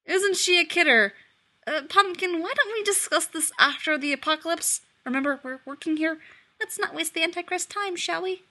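high, coaxing wheedle; smooth over the fight with pet names; oily soothing husband; placating sweet-talk. Acted. Belial placating wife.

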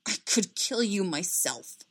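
slow, strangled exclamation; choked-up, half-strangled voice. A narrator's reading. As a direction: squeeze the words out slowly. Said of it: "Anakin said, strangled".

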